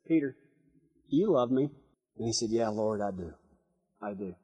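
very soft, low quoted exchange; hushed retelling. Retold or imitated. Preacher retells Jesus questioning Peter over breakfast, hushed. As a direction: drop right down, quiet and intimate.

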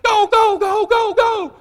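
high, loud rapid-fire urging; excited high-pitched holler; urgent call. Retold or imitated. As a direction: shout them into action. Calling hunters out of truck.

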